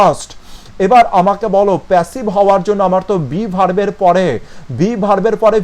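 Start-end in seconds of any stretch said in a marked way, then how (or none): 0.1-0.3 "asked" pronounced "ast"; silent k. Shown as a demonstration. Teacher confirms to students how "asked" sounds, silent k. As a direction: say "asked" without any k sound. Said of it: "এর উচ্চারণটা হবে".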